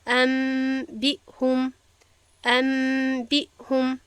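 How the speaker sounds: «ام بیهم» very slow with a held nasal m; drawn-out ghunna on «ام»; iqlab with ghunna. Shown as a demonstration. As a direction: hum the m before «بیهم».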